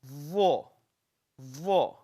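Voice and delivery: vo said clearly and slowly as a letter sound; letter sound demo. Shown as a demonstration. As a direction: model vo for the class. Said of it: "And then we have".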